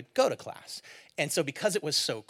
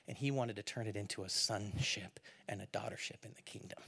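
whispered, softer climax line; whispered point; 4 semitones lower, 10 dB quieter than usual. Acted. Preacher whispering the key truth to the congregation. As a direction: drop it to a whisper.

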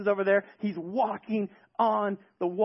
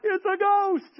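high, louder spooked cry; mock scared shriek; 11 semitones higher, 6 dB louder, slower than usual. Disciples to each other, a mock scared shriek. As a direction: yelp like a cartoon coward. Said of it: "But they're like".

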